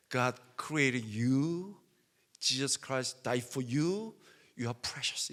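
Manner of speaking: very slow, tender preacher's English; gentle preacher voice. Retold or imitated. Preacher's words quoted in English.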